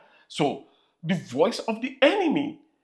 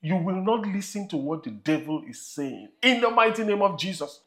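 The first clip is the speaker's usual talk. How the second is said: measured sermon cadence, rising to emphasis; preaching. Acted. Pastor preaching to his congregation about the devil, firm sermon voice.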